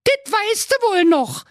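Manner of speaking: very high, fast scolding; indignant jilted girl. Acted. Former sweetheart reproaches the miser, indignant. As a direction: tear into him as a wronged girl.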